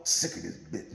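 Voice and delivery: low, soft, indistinct grumble; mumbling under breath. Retold or imitated. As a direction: imitate a husband grumbling under his breath. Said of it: "he's mumbling up under his breath".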